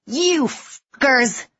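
slow, high, venomous curse; woman spitting rage at the marines; spat. A narrator's reading. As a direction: spit the words in hate. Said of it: "the woman spat".

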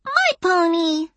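put-on slow, very high child voice; high, possessive preschooler delight; happy little girl. A narrator's reading. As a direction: claim it gleefully as a tiny kid. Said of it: "Maya said happily".

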